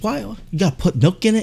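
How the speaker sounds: high, mock-indignant questioning; imitated irate customer. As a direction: put on a high outraged customer voice. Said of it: "He's yelling at the guy".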